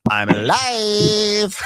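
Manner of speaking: high, long-drawn cry; over-the-top imitation of a famous outburst; hammed-up triumphant wail. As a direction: stretch it out in a mock dramatic howl. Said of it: "where Aegon famously goes".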